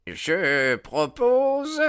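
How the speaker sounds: high, very slow aged voice; old secretary. A narrator's reading. The old secretary Haraway speaks up to the lodge, slow and high. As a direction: drag it out like an old greybeard.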